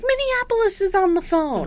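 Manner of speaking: high-pitched announcing voice; Harriet. Acted. Recapper as Harriet announcing a phone call, high sing-out.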